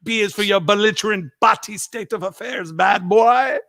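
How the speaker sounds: high-pitched mock Jamaican rant; imitated Jamaican dad. Drunk Jamaican dad scolding at four a.m., imitated rant. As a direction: announce it in a put-on Jamaican accent. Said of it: "If I try to do Jamaican".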